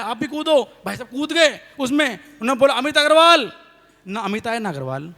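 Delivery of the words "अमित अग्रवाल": calling out. Retold or imitated. Calls name for echo.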